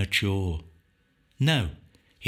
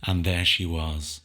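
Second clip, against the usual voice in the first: ordinary delivery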